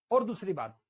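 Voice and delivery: usual speaking voice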